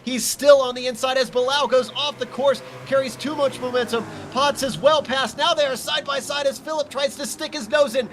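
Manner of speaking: high, urgent play-by-play; excited race call. Real, not acted. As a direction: ride the tension of the battle. Three-car battle live.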